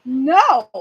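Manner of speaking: put-on a kid's emphatic no; flat refusal. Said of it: "And he goes".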